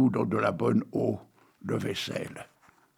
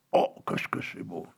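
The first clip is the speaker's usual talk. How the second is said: breathy, hushed and lower, overcome; mock-moved whisper; 7 semitones lower, 6 dB quieter than usual. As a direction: sigh it out as if moved to tears. Mock-moved reaction to sad ending. (murmure ému, faussement bouleversé)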